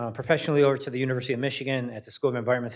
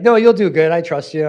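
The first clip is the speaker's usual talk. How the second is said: much louder, higher, cheering him on; eager encouragement; 5 semitones higher, 12 dB louder than usual. Retold or imitated. Teachers urging the nervous speaker, loud bright encouragement. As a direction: pump him up, you'll be great.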